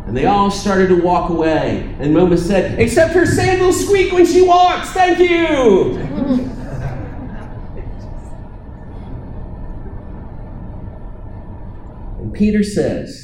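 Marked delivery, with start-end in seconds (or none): 2.8-6.0 much higher and louder, a gleeful gotcha; triumphant shout. Acted. Momus triumphantly finds a flaw. 6.0-12.1 soft laugh. Real, not acted. Speaker laughs at own joke.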